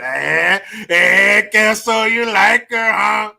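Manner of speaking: loud, drawn-out mock schoolkid taunt; nosy kids teasing. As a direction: tease loudly like nosy kids. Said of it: "kids are nosy and shit".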